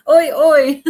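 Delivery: loud, laughing "Oi, oi" as a British cheer; British oi greeting. Shown as a demonstration. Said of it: "That's how they say hi".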